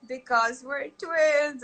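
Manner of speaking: put-on high, slow sing-out kid voice; gleeful little niece. Niece answering aunt's "you know why", gleeful kid voice. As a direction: draw it out high like a delighted child.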